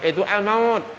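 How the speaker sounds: high, bright-voiced reply; people of paradise answering. People of paradise answering the angel, pitched high. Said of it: "Surga bilang".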